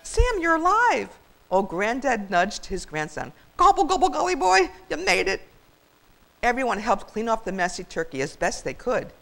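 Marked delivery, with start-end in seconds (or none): 0.0-1.2 overjoyed. Acted. Joyful reunion with saved turkey. 3.6-5.4 high, loud gobbling joy; delighted granddad. Acted. Granddad greets saved grandson.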